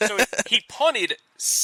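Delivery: a hammy, failed stab at Aussie; Australian accent. Pretend. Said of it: "You have to say it in an Australian accent".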